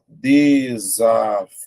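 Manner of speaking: "desafio" drawn out very slowly, de-sa-FI-o; syllable by syllable. Shown as a demonstration. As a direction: hold the FI long in a split-up "desafio".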